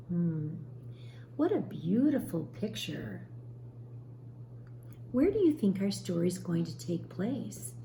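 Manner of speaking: usual speaking voice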